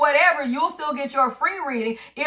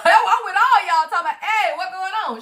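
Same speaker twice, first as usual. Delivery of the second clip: laughing mock greeting; 4 semitones higher than usual. Laughs mocking callers' chatter.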